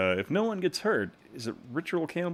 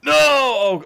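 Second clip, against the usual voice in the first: loud high yell of no; panicked shout; 11 semitones higher, 14 dB louder than usual. Real, not acted. Gamer shouts as the ball nears his own goal. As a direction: scream it in alarm.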